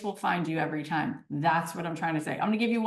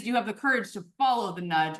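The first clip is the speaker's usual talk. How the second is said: ordinary delivery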